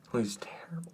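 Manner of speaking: whispered, soft verdict. Real, not acted. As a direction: whisper it like a secret.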